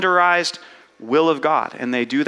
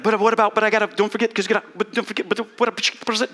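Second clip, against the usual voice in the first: higher, fretful scattered brain voice; jumpy, pitched up, losing the thread; anxious brain; 4 semitones higher, faster than usual. Retold or imitated. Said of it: "your brain's like".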